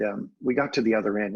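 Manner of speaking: normal talking voice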